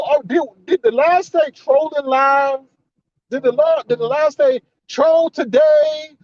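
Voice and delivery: high, slow sarcastic welcome; mock the host with high drawn-out sarcasm; sarcastic disbelief. Commentator sarcastically voices the host welcoming trolls, high and slow.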